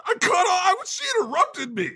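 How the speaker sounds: high whiny outraged squeal; mock tantrum. A comedian playing Jesus, miffed at being interrupted, in a hammed-up high tantrum. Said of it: "hear Jesus throw a tantrum".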